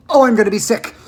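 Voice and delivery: loud, queasy yell. A narrator's reading. Missus T V on the swerving lift, yelling that she feels sick. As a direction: wail it while turning green. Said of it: "yelled Missus T V".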